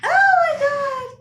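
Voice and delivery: very high, loud, drawn-out wail; hammed-up high shriek; distressed wail. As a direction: act out a frantic squealed cry. Reliving screaming in distress.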